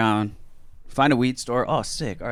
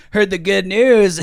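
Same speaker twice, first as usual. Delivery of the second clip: high, loud mocking cackle; all laughing; 9 semitones higher, 6 dB louder, slower than usual. Border officer walking in on detained band, all laughing. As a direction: imitate a gloating laugher, slow drawn-out words between laughs. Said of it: "walk in all laughing".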